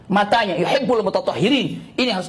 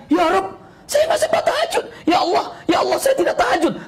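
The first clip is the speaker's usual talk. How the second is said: high-pitched, acted anguished plea to God; tearful lament; 10 semitones higher than usual. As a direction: cry out in dramatised distress, voice pitched way up. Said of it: "Sampai bergetar dan berlinang air matanya". (ratapan pilu bernada tinggi, diperagakan)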